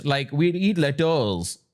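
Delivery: slow, drawn-out South Bombay parody; exaggerated South Bombay drawl; mock South Bombay accent. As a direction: drag the last word out in mocked South Bombay English.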